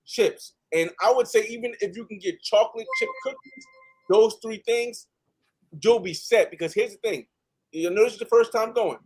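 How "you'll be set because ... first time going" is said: ordinary talk, nothing marked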